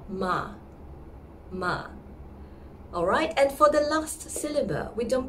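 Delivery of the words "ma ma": ma short and open; ma like mother. Shown as a demonstration. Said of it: "sounds like mother".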